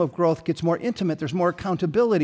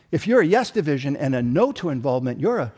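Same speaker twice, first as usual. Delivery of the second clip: normal talking voice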